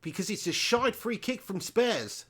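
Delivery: bad stab at an Aussie accent; Australian accent. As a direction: put on a jokey Australian twang. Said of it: "It's not my best accent".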